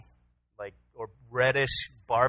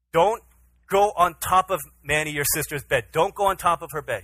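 raised, louder voice, firm bedtime order; stern dad warning; 5 semitones higher, 9 dB louder than usual. Retold or imitated. Father telling his son to stay in bed, stern. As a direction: spell out the rule for a small child.

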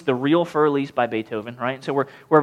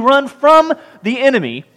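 FROM punched higher and louder; raised, forceful emphasis on FROM; stress on FROM; 8 semitones higher, 5 dB louder than usual. Real, not acted. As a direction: hit the word FROM hard. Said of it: "I emphasize those words".

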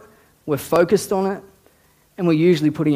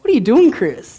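higher, louder exasperated question; mock self-rebuke; 6 semitones higher, 5 dB louder than usual. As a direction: take yourself to task, half amused. Retold rebuke to himself in spirit.

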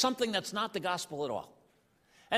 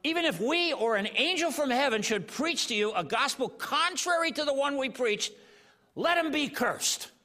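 fiery scripture; 7 semitones higher than usual. Retold or imitated. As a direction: preach the warning hard. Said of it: "He says".

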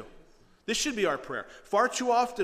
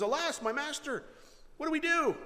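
very high, panicky wail; freaked out; 11 semitones higher than usual. Acted. Servant waking his master about the army, freaked out. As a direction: cry out to your master in alarm. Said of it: "He freaks out".

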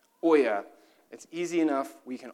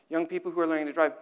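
normal talking voice

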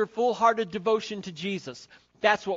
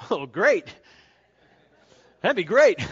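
sarcastic spouse; 10 dB louder than usual. Acted. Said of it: "some of them may say".

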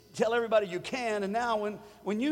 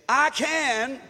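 higher, louder call to say it together; loud declaration; 5 semitones higher, 6 dB louder than usual. Real, not acted. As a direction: proclaim it out loud. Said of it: "say it with me".

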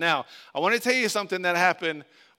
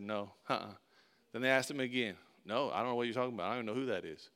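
soft, quick nervous denial; hushed denial; 8 semitones lower, 9 dB quieter, faster than usual. Acted. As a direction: brush them off under your breath. Peter denying Jesus three times.

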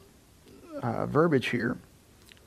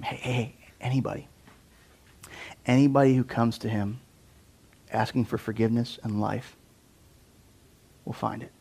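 tender; 5 dB quieter than usual. Real, not acted. Tender pastoral close.

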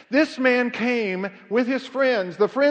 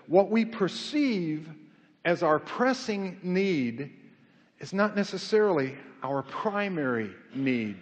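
slow, deliberate, lower toward the end; slow weighty quote; 4 semitones lower, 6 dB quieter, slower than usual. Acted. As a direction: lay out each phrase slowly. Quoting Andy Stanley.

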